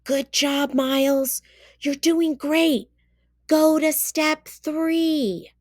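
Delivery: loud, high, eager praise; blurted cheer. A narrator's reading. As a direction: call it out to your classmate. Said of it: "Caden blurted out".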